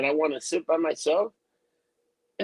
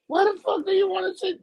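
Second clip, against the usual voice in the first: exasperated; 11 semitones higher than usual. Retold or imitated. Annoyed at stranger in theater.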